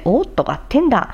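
ordinary talk, nothing marked

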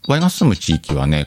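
ordinary delivery

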